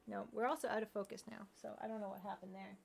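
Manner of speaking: ordinary delivery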